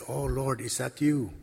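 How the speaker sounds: soft, awed question to an unseen voice; hushed and unsure, barely voiced. Man hears God in empty church, softly awed.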